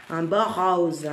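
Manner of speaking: rose said southern French style, open vowel; teasing southern French accent on "rose"; friend's southern French accent. Retold or imitated. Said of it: "comme dirait ma copine".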